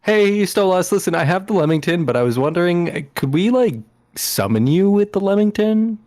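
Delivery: tentative request over the phone; Phoebe phoning a friend. Acted. Player voices Phoebe's phone call.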